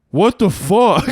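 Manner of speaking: loud, high "what the fuck" in disbelief; shocked outburst. Retold or imitated. Buddy reacting to the stranger slapping the phone, shocked loud outburst. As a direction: blurt it out stunned and loud.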